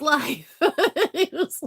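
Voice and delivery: laughing. Real, not acted. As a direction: voice breaks into laughter. Laughing mid-sentence.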